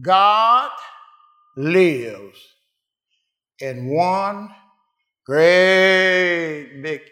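very slow, loud word by word; preacher proclaiming each word slowly; drawn-out proclamation. Real, not acted. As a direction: stretch every word like a revelation.

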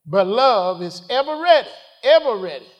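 high, loud preacher's cry; preaching climax. Acted. Preacher crying out to the congregation about love. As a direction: ring it out to the pews.

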